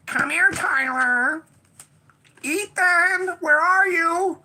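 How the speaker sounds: put-on a shrill-pitched toy mom calling out kids' names, slow; high calling doll voice. A fake booby machine doll calling for the kids by name. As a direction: call each kid's name like a creepy talking doll.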